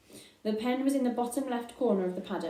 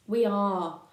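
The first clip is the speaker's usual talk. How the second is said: chorused; 4 dB louder than usual. Acted. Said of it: "chorused the flock".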